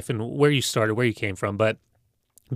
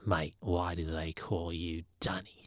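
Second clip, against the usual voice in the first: whispered quote; 6 semitones lower, 12 dB quieter, slower than usual. Retold or imitated.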